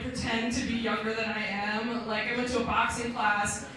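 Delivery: ordinary talk, nothing marked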